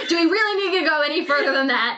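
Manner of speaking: fast, laughing teasing question. Real, not acted. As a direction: tease her while laughing. Teasing co-host about romance detail.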